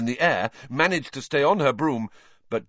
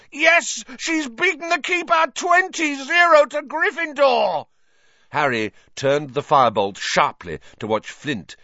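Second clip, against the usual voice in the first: excited play-by-play; 4 semitones higher than usual. A narrator's reading. Lee Jordan commentating the match, excited play-by-play. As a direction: call the action as it happens.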